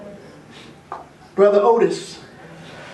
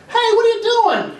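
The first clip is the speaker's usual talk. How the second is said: much higher, a curious stranger calling out; high-voiced passerby; 12 semitones higher than usual. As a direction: call out to the man on the beach, up high. Voicing a passerby in story.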